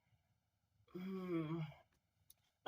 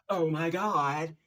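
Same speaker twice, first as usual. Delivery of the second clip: loud, drawn-out flamboyant gush; 4 semitones higher, 9 dB louder, much slower than usual. Retold or imitated. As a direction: stretch it out, theatrical and camp. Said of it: "is super flamboyant and he goes".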